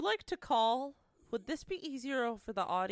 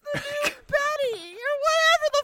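high, tearful wail through laughter; laugh-crying; 13 semitones higher, 6 dB louder, slower than usual. Real, not acted. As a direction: wail it while cracking up.

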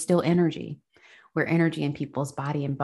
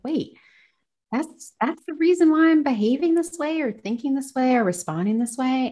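sudden realization; 9 semitones higher, 4 dB louder than usual. Retold or imitated. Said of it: "But we're like".

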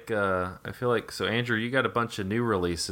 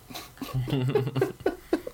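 laughing; 4 semitones higher than usual. Real, not acted. Laughs at his own impression.

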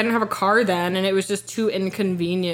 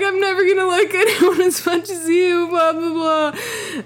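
mock crying; 10 semitones higher than usual. Imitating herself on ex's couch, hammed-up crying. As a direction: blubber it, hammed-up and lovesick. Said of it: "I'm literally crying".

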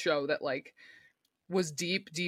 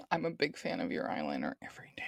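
soft breathy whisper; 12 semitones lower, 5 dB quieter, faster than usual. Pretend. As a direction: whisper it back teasingly. Whispered echo of friend's line.

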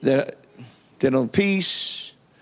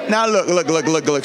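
normal talking voice

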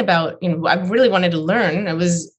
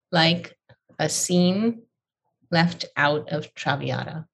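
slow, drawn-out recitation with pauses; each phrase held apart, slow; much slower than usual. Shown as a demonstration. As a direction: savor each word slowly. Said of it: "Slow, slower".

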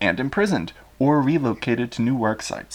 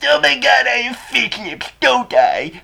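much higher and louder, squeaky; 14 semitones higher, 7 dB louder than usual. Acted. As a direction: squeak it out in panic. Ceausescu despairing.

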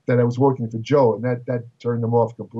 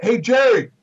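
calling out higher across a big room; retold yell across the gym; 8 semitones higher, 4 dB louder than usual. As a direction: shout his name to the far end of the gym. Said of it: "He'd yell out".

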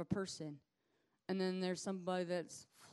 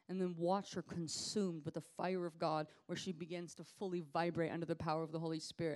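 ordinary talk, nothing marked

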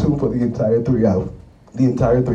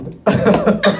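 high cackling laugh; cackle; 8 semitones higher than usual. Real, not acted. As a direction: break into a real cackle. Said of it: "That is a cackle for me".

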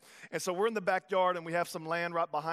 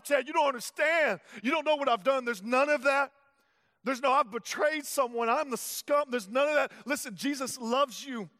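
loud, high, impassioned proclamation; full-force preaching; 5 semitones higher, 5 dB louder than usual. Acted. Pastor declaring no sin is too great, impassioned preaching. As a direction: preach it with everything you have.

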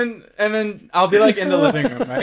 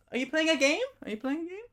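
high, light mom voice; playing mom; 11 semitones higher, 3 dB quieter than usual. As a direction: act the nosy mom asking questions. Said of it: "Oh, you want me to be her?".

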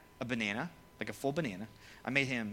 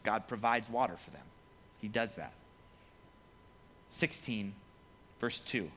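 normal talking voice

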